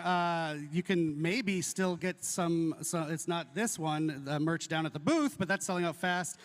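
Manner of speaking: ordinary talk, nothing marked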